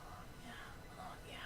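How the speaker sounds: barely audible whispered approval; murmuring to self. Retold or imitated. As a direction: whisper it, entranced by what is on screen. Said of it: "And I'm just like".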